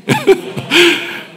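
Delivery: amused laugh. Real, not acted. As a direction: laugh at your own punchline. Laughs after the story.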